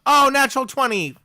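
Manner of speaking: loud, high burst of delight; real thrilled outburst; excited. Real, not acted. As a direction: exclaim at a lucky dice roll. Rolls a natural twenty.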